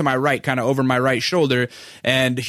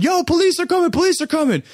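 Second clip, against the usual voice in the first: high-pitched imitation of a yell; yelled warning. Somebody warns the brawlers police are coming, yelling. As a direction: call out the alarm, voice way up. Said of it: "somebody starts yelling".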